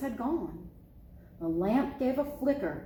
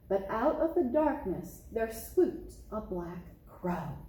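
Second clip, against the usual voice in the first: usual speaking voice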